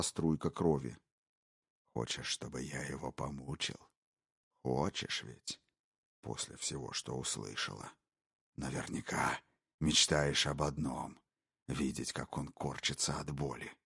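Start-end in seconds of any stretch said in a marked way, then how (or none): 1.9-13.7 low, hushed, gloating whisper; a predator savouring every word; menacing intruder. Acted. Intruder taunting the wife, low sinister whisper. Brogan's voice.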